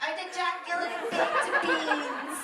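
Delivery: words spilling out through laughter; laughing. Real, not acted. Player cracking up at their own joke, laughing. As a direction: laugh your way through the line.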